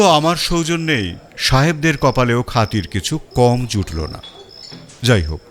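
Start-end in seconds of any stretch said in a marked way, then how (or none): none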